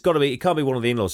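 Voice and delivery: fast, hurried reasoning; rushed muttering. Retold or imitated. Scrooge working out the thief, fast and hurried. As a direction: blurt it out quickly. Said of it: "he says".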